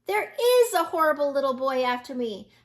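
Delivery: this time he means it; yelled. A narrator's reading.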